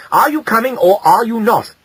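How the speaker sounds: high, sharp scolding mother; angry. A narrator's reading. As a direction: demand an answer from a stubborn child. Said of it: "said his mother angrily".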